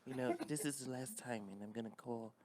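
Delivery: soft, hushed [name]; quiet threat like [name]. Pretend.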